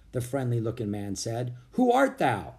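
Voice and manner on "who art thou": pilgrim man voice. A narrator's reading. Stern pilgrim questions strangers.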